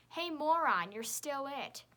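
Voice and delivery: loud jeering call across the playground; rough schoolyard shout. A narrator's reading. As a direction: holler the taunt. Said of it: "Alex DeGallo shouts at me".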